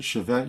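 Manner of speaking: chevet as shuh-VET, final T sounded; pronounced as -ette. Shown as a demonstration. Quoting the rule on French spelling, chevet with sounded final T. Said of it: "you have to spell it E T T E".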